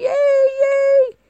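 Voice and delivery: shrill mock cheer; cheering Russian fans. Mimicking Russian fans cheering their team, mock cheer. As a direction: cheer like a proud little fan. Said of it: "little flags and their pride".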